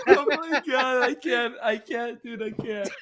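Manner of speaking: high, breathless words through laughter; helpless with laughter; laughing. Real, not acted. Friend cracking up at the Eren Yeager joke, laughing.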